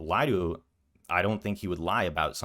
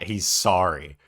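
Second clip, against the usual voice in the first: mocking; 7 dB louder than usual. Mocks the creator's main argument.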